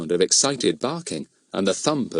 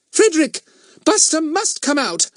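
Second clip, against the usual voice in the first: very high, exasperated mother's cry; 4 dB louder than usual. Acted. Mother orders the dog out of the bed, crying out. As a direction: call out sharply as a scandalised mother. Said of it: "cried Missus Trotville".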